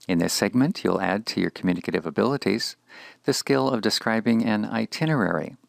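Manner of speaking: normal talking voice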